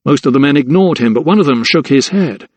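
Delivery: ordinary delivery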